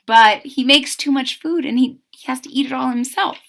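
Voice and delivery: ordinary delivery, nothing marked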